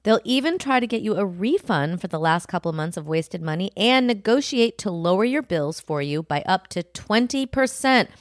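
upbeat sponsor pitch read to listeners. Acted. Host pitching a finance app to listeners, bright ad read. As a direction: sell the app like a podcast host.

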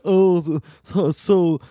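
high, slow, snooty mock aunt; imitating a judgmental aunt at the wedding. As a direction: drawl it like a sniffy relative. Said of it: "everybody was like".

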